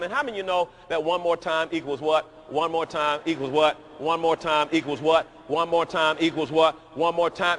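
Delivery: rhythmic repeated preaching call. Acted. Preacher drilling the crowd with a chant. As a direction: drill the phrase at the congregation.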